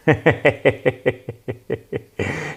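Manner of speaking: mock evil villain cackle; machiavellian laugh. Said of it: "Je sors mon rire machiavélique".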